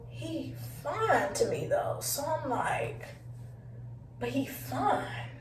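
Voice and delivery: slow, soft, half-whispered swoon; hushed smitten admission. Retold or imitated. Torn woman admitting her crush is attractive, hushed and slow. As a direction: let it sink to a slow murmur.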